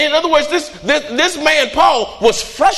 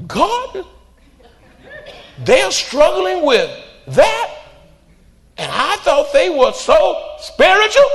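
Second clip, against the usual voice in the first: mock shock; 9 semitones higher, much slower than usual. Shocked at someone's secret struggle.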